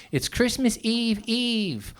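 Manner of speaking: high, slow giddy excitement; hammed-up childish glee; mock giddy kid. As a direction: gush like an overexcited kid. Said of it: "he wrote".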